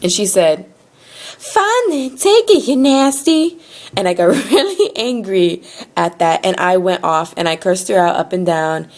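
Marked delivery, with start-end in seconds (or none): 1.4-3.6 high, yelling country lady impression; mock a yelling woman's country accent. Tow lady throws license back at customer, shrill country accent. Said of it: "yelling at me in her little accent".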